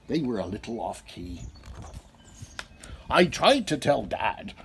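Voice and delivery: usual speaking voice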